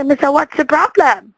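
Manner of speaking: ordinary talk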